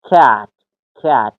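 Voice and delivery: کت as English "cat", with open A; short A pronunciation. Shown as a demonstration. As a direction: demonstrate کت with the short A. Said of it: "این هم فونتیکش یا تلفظش".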